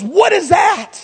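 dramatized wife in labor demanding an answer, mock shout; dramatized anger. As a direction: ham up an outraged wife. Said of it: "She wasn't that angry. I'm dramatizing".

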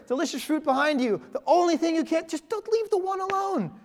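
can't believe they missed it; high mock-exasperated scolding; exasperated at Adam and Eve. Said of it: "Have you ever had that thought?".

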